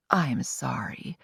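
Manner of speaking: soft, slow, small voice; quiet apology. Acted. Ada apologising quietly to Cherish after losing her bird. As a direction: say sorry quietly, almost shrinking. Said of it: "Ada was being very quiet".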